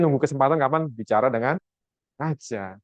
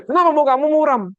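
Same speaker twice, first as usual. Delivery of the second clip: demanding king; 12 semitones higher, 5 dB louder than usual. Retold or imitated. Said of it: "Waktu raja".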